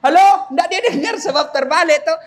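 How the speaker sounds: loud, high phone "Halo"; hollered hello. Acted. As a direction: shout "Halo" into the receiver. Berteriak di telepon yang terbalik. ("Halo" diteriakkan di telepon)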